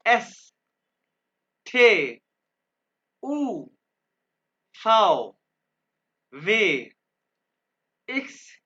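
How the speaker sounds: each letter called out loud and drawn out, one by one; German alphabet drill. Shown as a demonstration. Teacher reciting the German alphabet for students to repeat, loud and clear. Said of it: "यहाँ पे जुबान को vibrate करना है".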